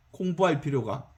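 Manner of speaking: 공부할 필요가 dropped low and soft; quiet echo. Shown as a demonstration. Preacher echoing his point, low and soft. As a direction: murmur 공부할 필요가 back to yourself. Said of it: "quiet echo of line 42".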